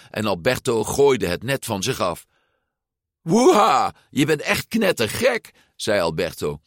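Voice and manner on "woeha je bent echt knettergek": high, loud whoop, easing in volume; exhilarated whoop. A narrator's reading. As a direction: exclaim in delight at a wild friend. Friend whoops during the escape. (uitgelaten juichend)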